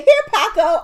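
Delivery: squeaky high mock call of a name. Friend retelling the sitcom assistant's line, high squeaky call. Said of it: "When she said".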